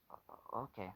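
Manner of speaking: soft, stammered under the breath; under his breath. Retold or imitated. Boy agrees to come along, stammering under his breath. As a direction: falter quietly into agreeing. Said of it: "He says that under his breath".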